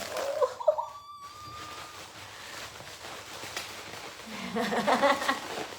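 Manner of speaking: very high, shrieking laugh of delight; delighted squealing laugh. Real, not acted. Unboxer shrieks with laughter on first seeing the nutcracker, squealing. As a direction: let out a squeal of pure joy.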